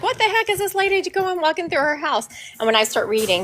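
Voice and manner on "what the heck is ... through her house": puzzled viewers. Retold or imitated. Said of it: "they're like".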